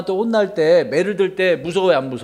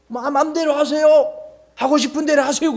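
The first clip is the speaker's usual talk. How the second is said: mocking defiance; 9 semitones higher than usual. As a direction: play the scoffer who fears no God. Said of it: "그런단 말이에요".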